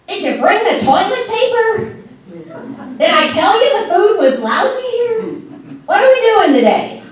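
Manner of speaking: put-on high, whiny nagging old mother; shrill complaining mom voice. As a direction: rattle off complaints like a needy mother.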